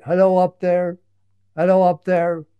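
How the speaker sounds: loud, high call up into the treetops; shouting up. Acted. Barrington the bunny calling up to the squirrels, shouted. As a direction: call up loudly to someone high in a tree. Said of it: "he shouted up".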